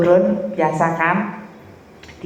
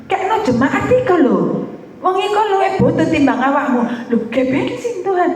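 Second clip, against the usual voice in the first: laughing; 11 semitones higher than usual. Retold or imitated. Retells Holy Spirit, laughing.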